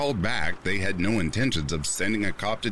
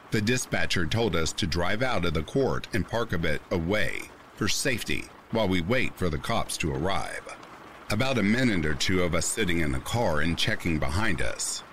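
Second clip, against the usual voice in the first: ordinary delivery, nothing marked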